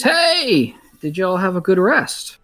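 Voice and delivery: high, cheery sly greeting; a tiefling's sing-song hello. Acted. GM voices the tiefling NPC.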